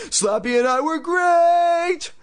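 high, loud, slow bragging shout; excited shout. Acted. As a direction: crow it out loud. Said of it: "Lindy exclaimed".

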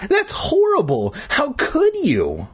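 very high, drawn-out shriek; hammed-up sisterly outrage, voice way up. A narrator's reading. Sister accusing Joe over the melons, shrieking. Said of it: "she shrieked".

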